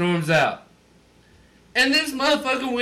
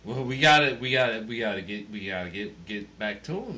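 ordinary delivery

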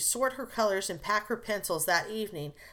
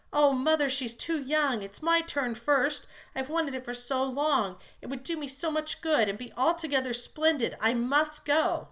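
pleading eagerly; 6 semitones higher than usual. A narrator's reading. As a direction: beg Mother as if your life depends on it. Jo begging to go abroad.

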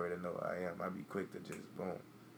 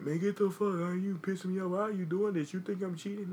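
mock angry yelling; 11 semitones higher, 6 dB louder than usual. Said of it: "It ain't gonna be no like".

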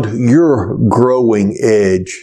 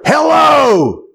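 roared hello; 13 semitones higher than usual. Acted. Man answering the phone for the third time, roaring. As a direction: bark it like a furious man picking up. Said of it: "a violent voice roared".

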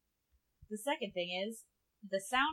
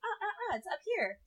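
pitched way up high; high register; 10 semitones higher, faster than usual. Shown as a demonstration. Showing where the work voice sits, high register. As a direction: show how high the voice sits. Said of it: "up here in this register".

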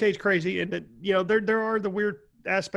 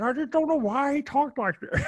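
Jimmy Stewart; 4 semitones higher than usual. Retold or imitated. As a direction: play up a classic actor's accent. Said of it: "he kinda went Jimmy Stewart".

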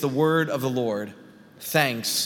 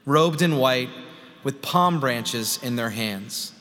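solemn, measured public Bible reading; scripture reading; 4 semitones higher, 5 dB louder than usual. Acted. Pastor reading Revelation to the congregation, solemn scripture reading. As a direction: proclaim the passage with deliberate pauses between phrases.

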